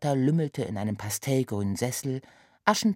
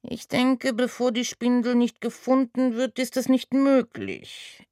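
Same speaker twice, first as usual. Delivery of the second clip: Remus voice; 9 semitones higher, 5 dB louder than usual. A narrator's reading. Remus answers the cat.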